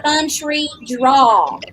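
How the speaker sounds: slow, stretched-out Southern country drawl. Shown as a demonstration. Showing the co-host how her accent gets triggered, full-on country drawl. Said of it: "to having a full-on country drawl".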